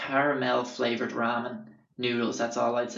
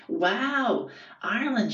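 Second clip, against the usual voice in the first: higher, excited mock girl voice; impressed girl; 9 semitones higher, much slower than usual. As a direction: play the wowed stranger guessing the accent. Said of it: "she was like".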